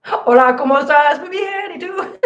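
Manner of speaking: high-pitched, hammed-up Spanish accent; bad Spanish accent. Shown as a demonstration. Showing off some Spanish to viewers in a hammed-up Spanish accent. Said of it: "I probably suck at that accent".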